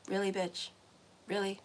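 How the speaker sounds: low, flat, unimpressed; deadpan side-eye; quiet disbelief. Retold or imitated. Reader answering clients who run back to exes, deadpan. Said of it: "And it's like".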